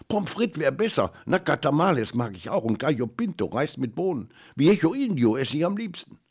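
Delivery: put-on high, eager kids talking over each other; excited child voices. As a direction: chirp like hungry children. Children chattering about food.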